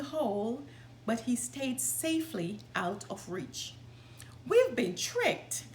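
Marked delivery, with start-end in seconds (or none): 4.5-5.7 high, slow angry cry. A narrator's reading. The tricked men realizing Tom escaped, angrily. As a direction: let the anger out at being fooled. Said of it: "they said angrily".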